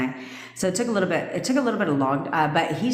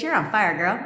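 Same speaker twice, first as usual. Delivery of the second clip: normal talking voice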